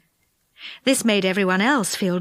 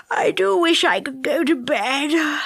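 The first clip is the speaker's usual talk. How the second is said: higher, yawny wishful voice; sleepy yawning; 4 semitones higher than usual. A narrator's reading. Moonface longing for bed, talking through a yawn. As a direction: let an acted yawn stretch the words.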